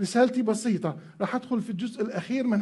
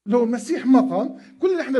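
loud emphasis; 3 semitones higher, 6 dB louder, faster than usual. Real, not acted. Preacher stresses the key point.

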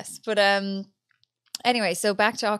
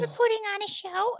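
higher, breezy showgirl answer; high sing-song reply; 11 semitones higher than usual. Retold or imitated. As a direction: chirp it back innocently. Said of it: "we were like".

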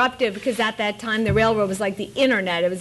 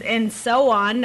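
ordinary talk, nothing marked

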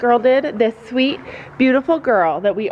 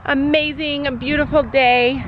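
ordinary talk, nothing marked

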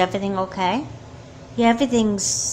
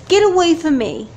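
loud, high shout waking from a nightmare; scared yell from a bad dream; frightened shout; 9 semitones higher, 8 dB louder than usual. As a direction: push her away with your voice. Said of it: "all you could say is".

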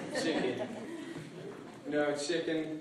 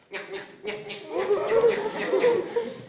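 hyena cackle. Acted. Cunning hyena laughs.

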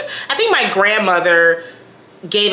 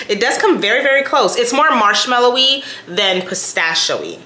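usual speaking voice

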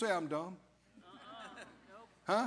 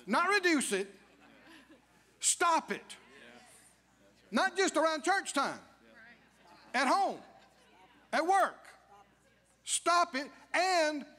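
emphatic preaching; 5 semitones higher than usual. Real, not acted. Preacher insists firmly.

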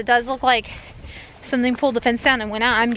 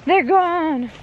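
alarmed; 6 semitones higher, much slower than usual. Retold or imitated. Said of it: "I was like".

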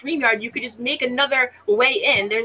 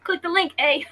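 lightly put-on Canadian accent, higher; mock Canadian; 5 semitones higher than usual. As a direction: tease with a Canadian "eh". Teasing a Canadian with "eh.

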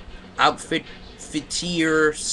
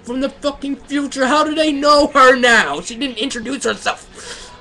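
much higher and louder, real disbelief; incredulous rant; 11 semitones higher, 6 dB louder than usual. Real, not acted. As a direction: rant at the plot, pitch way up. Ranting at the story's logic.

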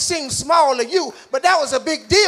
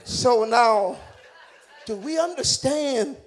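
usual speaking voice

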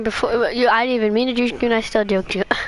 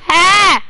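loud, high sudden cry; jolted by a sudden hit; sharp loud shout of shock; startled yell; 7 semitones higher, 10 dB louder than usual. Real, not acted. Sudden game death.

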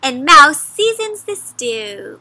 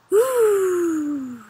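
rasping in-breath, long whooshing out-breath; pretend snore; 4 semitones higher, 3 dB quieter than usual. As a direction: snore like a sleeping bear. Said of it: "Bear snores".

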